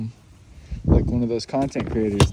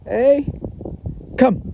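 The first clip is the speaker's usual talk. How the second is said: calling out; 12 semitones higher than usual. Real, not acted. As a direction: shout for the dog across the camp. Calling the dog.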